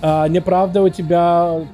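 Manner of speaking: usual speaking voice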